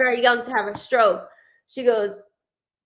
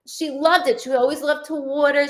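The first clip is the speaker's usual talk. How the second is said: ordinary delivery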